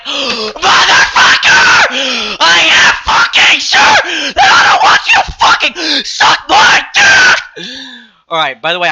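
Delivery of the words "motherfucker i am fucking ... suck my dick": high, loud, slowed scream cracking into laughter; imagined outburst at the creep, screaming; furious scream. Retold or imitated. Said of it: "I didn't say this".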